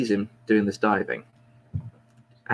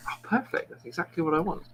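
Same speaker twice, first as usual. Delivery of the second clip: much higher, half-whispered delight; squeaky delighted homeless man; 7 semitones higher, 5 dB quieter than usual. Retold or imitated. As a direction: pipe up, tickled by the bargain.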